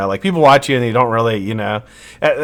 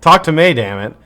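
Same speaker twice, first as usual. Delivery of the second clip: ordinary talk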